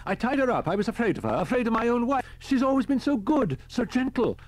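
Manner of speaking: very high, loud anguished wail; reliving the horror. A narrator's reading. Man confesses he tied up his wife, hysterical horror. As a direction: cry it out in high-pitched hysteria. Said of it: "The man was reliving the horror".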